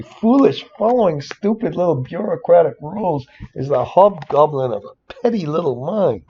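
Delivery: high, heated rant about stupid rules; raving rant. Real, not acted. Spreadsheet tutor ranting to viewers about bureaucratic rules, high and heated. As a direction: let the indignation run away with you, pitch climbing high. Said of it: "I'm gonna rave again".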